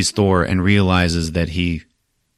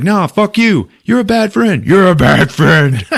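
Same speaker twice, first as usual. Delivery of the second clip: put-on high, rising childish squabble; mock arguing; 10 semitones higher, 5 dB louder than usual. Hulk arguing with Thor, hammed-up anger. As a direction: pitch it up, louder and drawn out by the end. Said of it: "they start arguing".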